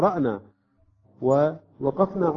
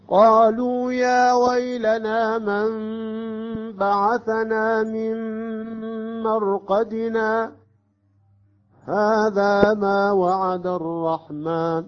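slow, high chanted recitation, louder; Quran recitation; 7 semitones higher, 4 dB louder, much slower than usual. Shown as a demonstration. Teacher recites Ya-Sin verse fifty-two in chant. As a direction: sing the verse in tajweed chant. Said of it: "الآن طريقة السكت".

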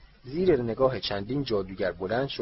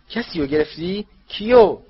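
calls out, rising higher and louder; loud question; 9 semitones higher, 11 dB louder than usual. Acted. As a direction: shout the question, urgent. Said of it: "آقای کراوچ بلند پرسید".

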